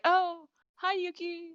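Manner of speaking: put-on very high, sing-out girl voice; mock-sweet high greeting; imitated girlish greeting. Retold girl greets the boy.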